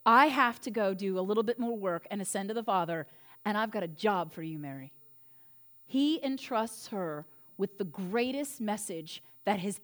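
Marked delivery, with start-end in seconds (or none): 0.0-4.9 lower, gentle Jesus voice; steady and kind, then fading on "Mary"; a lightly voiced Jesus telling Mary to wait; Jesus speaking. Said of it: "And Jesus says".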